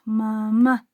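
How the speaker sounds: "mamá" split, final "má" hit hard; stress on last syllable. Shown as a demonstration. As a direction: land the beat on the "má" of "mamá". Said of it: "Esta sería la, la sílaba tónica". ("mamá" marcando fuerte la última sílaba)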